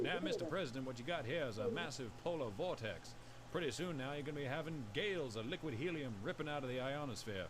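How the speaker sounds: a Southern chief scientist bursting in with urgent news. Pretend. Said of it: "the chief scientist bursting into".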